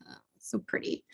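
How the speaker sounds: soft whisper to herself; whispered admiration. Real, not acted. Showing the bottle to viewers, whispered aside. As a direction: hush it like a small treasure.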